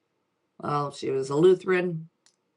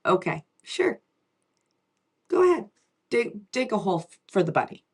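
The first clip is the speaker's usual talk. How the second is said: lightly voiced indulgent mom, jumping higher on go ahead; mother humoring her daughter about the bunny hole; imitating mom; 4 semitones higher than usual. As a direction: humor the child, with a sudden high lift. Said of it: "she was like".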